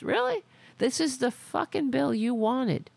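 sharp high disbelieving Really; mocking incredulous question. Real, not acted. Incredulous rant at Trump.

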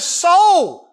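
very high, slow, loud cry; desperate plea to his soul. Acted. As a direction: stretch it into an anguished cry. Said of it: "he cries out to him".

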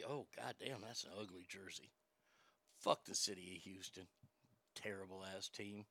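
muttered under the breath; blurted confession. Real, not acted. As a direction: grumble it half to yourself. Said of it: "Did I say that out loud?".

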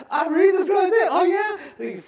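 high, loud acted shouting; shouted exchange. Rider and Philip talking alongside chariot, playfully shouting. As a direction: shout it over a moving chariot.

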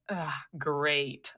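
low, soft, deflated reaction; imitating an unimpressed girl; unimpressed groan. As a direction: grumble it quietly, let the disappointment show. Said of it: "like that".

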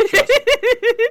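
very high, laughing promise; host teasing with a laughing promise. Real, not acted. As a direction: squeal it through a laugh.

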